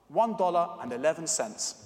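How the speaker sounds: ordinary talk, nothing marked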